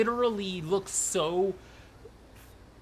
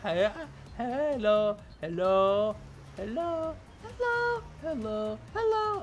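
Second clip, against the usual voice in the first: trying female voices; 5 semitones higher, much slower than usual. Shown as a demonstration. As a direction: test out high female character voices. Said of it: "what's a regular female voice?".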